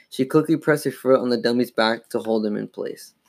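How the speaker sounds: ordinary delivery, nothing marked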